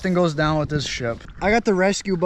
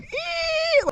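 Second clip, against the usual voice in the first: squeaky hiss imitation. Imitating hissing noise.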